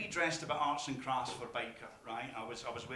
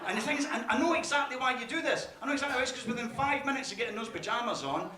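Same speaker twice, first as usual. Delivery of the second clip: ordinary talk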